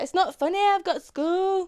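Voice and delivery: slow, drawn-out Yorkshire whine on "I've got school"; broad Yorkshire meme line. Retold or imitated. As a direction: mimic the little girl in broad Yorkshire. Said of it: "she's like".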